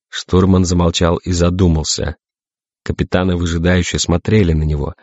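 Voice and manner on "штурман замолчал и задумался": plain reading voice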